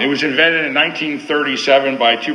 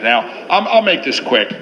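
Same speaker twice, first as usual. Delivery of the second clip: ordinary talk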